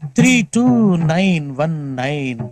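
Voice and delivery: phone number stretched out very slowly; drawn-out digits. Acted. Giving out his cell number, slowly drawn out. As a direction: linger on every digit, falling away at the ends.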